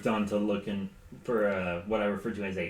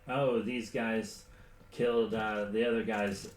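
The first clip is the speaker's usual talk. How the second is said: normal talking voice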